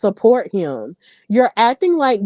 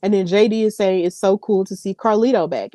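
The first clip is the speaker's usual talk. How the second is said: ordinary delivery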